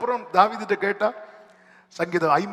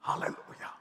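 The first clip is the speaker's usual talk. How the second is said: whispered hallelujah; 9 dB quieter than usual. Real, not acted. Whispered praise aside.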